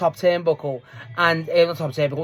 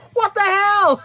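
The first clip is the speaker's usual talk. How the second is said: shocked outcry; 6 dB louder, slower than usual. Retold or imitated. Wrestlers and referee watching the oranges, mock shocked outcry. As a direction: imitate a high, loud, incredulous outcry.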